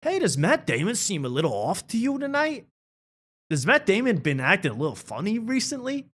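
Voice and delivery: prying with fake worry, high; Ben Affleck voice. Friend asks about Matt, mock-concerned and high. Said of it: "they're going".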